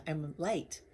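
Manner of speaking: normal talking voice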